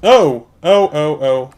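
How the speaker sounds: sudden loud startled oh; startled cry. Real, not acted. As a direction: jump in fright and cry out. Sudden monster appears, startled.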